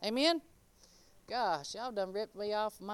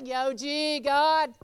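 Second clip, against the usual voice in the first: mock street greeting; 4 semitones higher, 8 dB louder, much slower than usual. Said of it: "I can't say".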